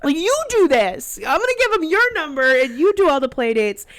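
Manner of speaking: mock fed-up complaint to husband; exasperated. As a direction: drag it out, fed up.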